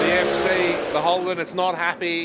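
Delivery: loud, high, urgent commentary; excited race call. Real, not acted. Excited call of engine problems.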